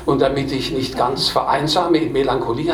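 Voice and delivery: plain reading voice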